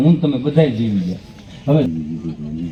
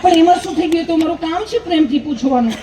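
high, sweet nurse voice, much higher; nurse's voice. Retold or imitated. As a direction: mimic the nurse asking politely. (ઊંચા મીઠા અવાજે નર્સની નકલ)

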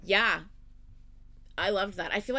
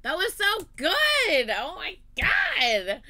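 loud, drawn-out amazed outburst; blown away; 2 semitones higher, 7 dB louder, much slower than usual. Real, not acted. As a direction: stretch the big words out, overwhelmed and loud. Blown away right after video.

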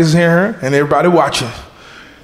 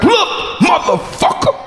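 higher, shouted confrontation; higher-pitched angry challenge; aggressive yell; 13 semitones higher than usual. Retold or imitated. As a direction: bark it at the guy behind you. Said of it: "I had already aggressively said".

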